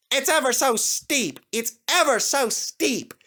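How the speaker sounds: chugging complaint; straining engine. Acted. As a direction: puff it out like a struggling engine. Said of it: "he said to Edward".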